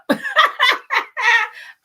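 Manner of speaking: shrieking laugh; high squealing burst of laughter; real high-pitched laugh. Real, not acted. Laughing at the name.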